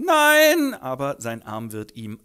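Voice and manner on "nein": lauter, sehr hoher Schrei; verzweifeltes Nein. Acted. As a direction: schrei gegen die Amputation an. Arm muss ab. (Franz hört, der Arm muss ab: Schrei)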